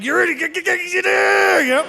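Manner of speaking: high, stammering sputter, words tripping over each other; mock furious stammer; sputtering angry cop. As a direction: choke on the rage, repeating get. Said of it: "He was so angry. He's like".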